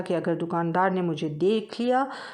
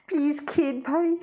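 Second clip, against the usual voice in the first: pleading; 9 semitones higher, slower than usual. Acted. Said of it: "मिन्नत समाजत करते हुए सर झुकाकर बोली".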